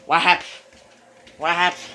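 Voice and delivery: high, loud startled question; startled what happened. Real, not acted. Vlogger startled by something on camera, loud rising question. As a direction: blurt it out, alarmed and rising.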